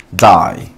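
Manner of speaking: dye dropped low, ending in a long 'ai'; long I sound. Shown as a demonstration. Teacher showing learners the English for teñir, long I sound. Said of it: "sonará como un long I sound".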